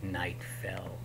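whispered, soft narration; hushed. A narrator's reading. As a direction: whisper it ominously.

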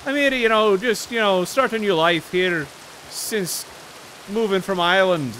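light Irish lilt, imitated; Irish accent. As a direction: do a cheerful Irish immigrant voice. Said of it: "And he'd talk like this".

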